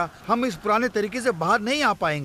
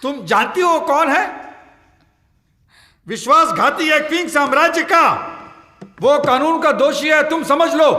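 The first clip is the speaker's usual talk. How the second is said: loud, high, outraged scolding; furious denunciation, getting louder; angry shouting; 8 semitones higher, 7 dB louder than usual. Acted. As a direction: berate them at the top of your voice. Furious man berates woman, denounces rebel.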